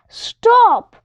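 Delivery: sharp high yell; yelling stop. A narrator's reading. Joe stops the monster filing a bird, high yell. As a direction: shout it to halt the monster. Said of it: "when Joe jumps up and yells".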